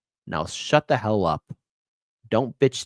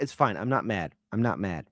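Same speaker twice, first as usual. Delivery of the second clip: ordinary talk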